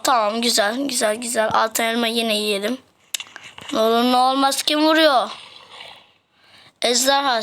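normal talking voice

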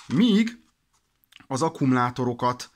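ordinary talk

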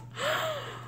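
high, soft intake of breath; real thrilled gasp; delighted gasp. Real, not acted. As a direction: gasp as if overcome by cuteness. Gasps at the head wrap.

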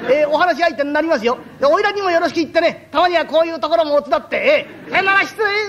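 all smiles, utterly unbothered; lightly voiced shameless lodger; cheeky freeloader. Freeloader chats from futon room.

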